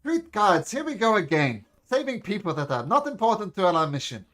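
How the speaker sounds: loud, high weary exasperation; exasperated companion. Acted. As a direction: complain loudly like a fed-up ally dragged along. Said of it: "Then says".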